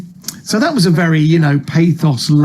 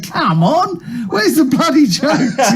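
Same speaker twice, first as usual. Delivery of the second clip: high, slow mock heckle with a laugh; a hint of an annoyed punter; heckling audience; 9 semitones higher, slower than usual. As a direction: laugh out an impatient punter's jeer. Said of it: "seeing people going".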